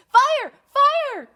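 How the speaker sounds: high, loud cry of fire; alarm shout. Acted. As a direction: yell the alarm to scare the birds. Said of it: "he cried".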